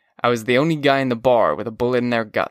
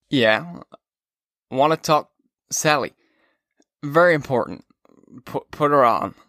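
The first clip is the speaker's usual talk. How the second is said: put-on drunk; 3 semitones higher than usual. A narrator's reading. Drunk Holden on phone.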